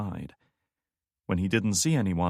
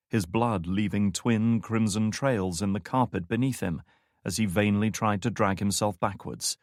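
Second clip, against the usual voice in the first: ordinary talk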